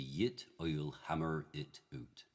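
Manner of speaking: slow Shakespearean original-pronunciation accent. Shown as a demonstration. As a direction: say it in OP, "yit oil hammerr it oot". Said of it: "which would be ... O P".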